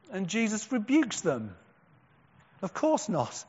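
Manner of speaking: ordinary talk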